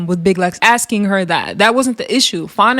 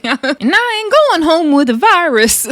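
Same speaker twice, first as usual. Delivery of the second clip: high, drawn-out imitated holler; mock yelling; 8 semitones higher, 3 dB louder than usual. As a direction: slowly holler it as a comic impression. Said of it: "the way she was yelling".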